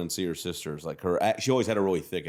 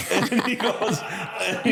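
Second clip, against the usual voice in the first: laughing; 10 semitones higher, slower than usual. Real, not acted. Laughing mid story.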